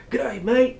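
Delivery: playful mock Australian wildlife-host greeting; hint of an Australian Crocodile Hunter voice. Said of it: "I tried to do like the crocodile hunter".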